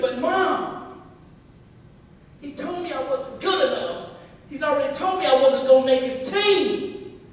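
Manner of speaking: put-on loud, high wail of a frustrated boy; acted son crying out in objection; upset protest. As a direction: plead with mother at full volume.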